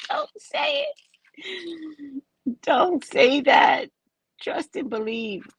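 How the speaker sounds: high squeak building to a slow shout; a hammed-up high voice scolding herself; mock warning voice. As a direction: warn yourself in a squeaky voice, then yell.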